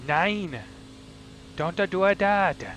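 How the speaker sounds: put-on loud, high German with a Chinese accent; German-Chinese hybrid accent. German spotter scolding the driver, mock Chinese-German accent. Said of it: "he's still got a Chinese accent, but he's German".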